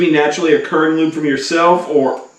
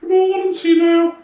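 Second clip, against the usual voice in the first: high silly voice; 11 semitones higher than usual. Pretend. High put-on voice.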